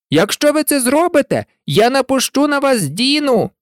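high, forceful calling out; shouted threat. A narrator's reading. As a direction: threaten them at the top of your voice. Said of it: "з усієї сили гукнула". (погрозливий вигук)

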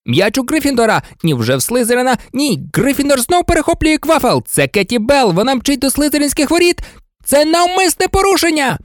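high play-by-play climbing to an outraged cry; excited match commentator. Acted. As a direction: track the ball, then call the foul.

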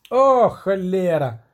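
loud, drawn-out curse; echoed cry. A narrator's reading. Bard echoing the witcher's cry, loud drawn-out curse. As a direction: let the curse ring out slowly. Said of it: "луною підтримав Любистрик".

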